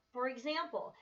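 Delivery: ordinary delivery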